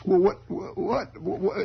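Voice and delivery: high, slow nervous stutter; stammering kid. Retold or imitated. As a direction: stutter high like a scared boy.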